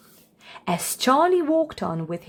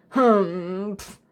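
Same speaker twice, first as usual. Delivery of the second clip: grandpa's humph. A narrator's reading. Grandpa disapproves.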